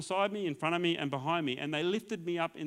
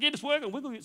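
excited nurses; 9 semitones higher, faster than usual. Retold or imitated. Excited nurses react to foot.

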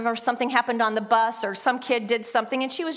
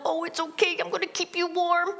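put-on tender baby talk; 7 semitones higher than usual. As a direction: coo it gently like soothing a toddler. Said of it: "you just snuggle".

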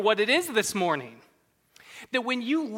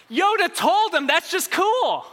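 mocking; 10 semitones higher, 5 dB louder than usual. Mocking Yoda's advice.

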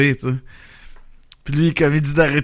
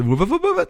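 high wou-wou whoops, mimicking the guy; imitated whooping noise. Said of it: "le bruit du gars".

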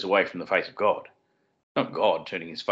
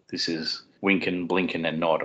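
ordinary delivery

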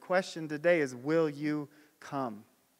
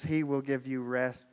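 ordinary talk, nothing marked

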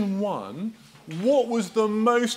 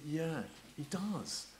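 quiet inner voice; 12 dB quieter, slower than usual. Retold or imitated. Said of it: "You think".

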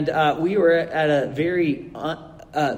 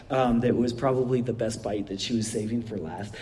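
usual speaking voice

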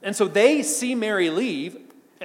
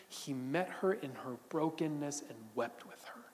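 whispered and much softer; 5 semitones lower, 13 dB quieter than usual. Acted. Preacher telling the congregation of Jesus weeping, whispered. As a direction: breathe it out as a tender secret. Tender climax of the sermon.

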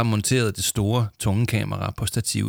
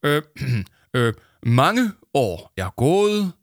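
slow, pompous and higher, like a silver wedding toast; stiff speech voice; 7 semitones higher, 5 dB louder, slower than usual. Acted. Arthur reading a solemn script: stiff, pompous speech voice. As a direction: Arthur reads his script too grandly. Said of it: "Det lyder som om, at du holder sølvbryllupstale".

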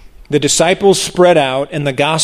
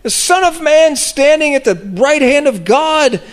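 much higher, rapt exclamation; ecstatic proclamation; 12 semitones higher than usual. Retold or imitated. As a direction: voice a man seeing heaven open, lifted high. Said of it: "he says this out loud".

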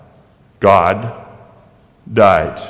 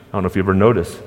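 ordinary talk, nothing marked

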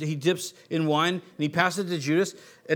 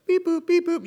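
high mock computer beeps, thinking it through; robot beeps; 11 semitones higher than usual. Preacher mimics a mind working it out, playful high beeps. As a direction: beep boop like a brain computing.

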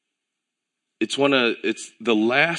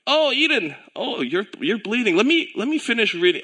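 much higher, hammed-up careless father; mock indifference; 11 semitones higher than usual. Preacher mocking a careless father ignoring his bleeding daughter, high and hammed-up. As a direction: play the parent too busy to care. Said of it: "what if I was like".

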